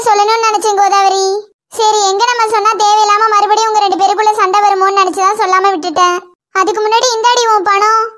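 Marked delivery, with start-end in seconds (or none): none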